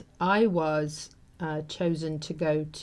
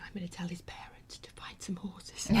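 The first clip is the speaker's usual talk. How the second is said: barely voiced, much softer, too moved to speak; choked whisper; 13 dB quieter than usual. The teacher thanking the deputy, in a choked whisper. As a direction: whisper it through tears. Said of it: "she couldn't speak".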